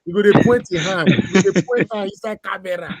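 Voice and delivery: loud, high, laughing tease in Pidgin; playful teasing. Real, not acted. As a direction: rib him hard, half shouting with fun.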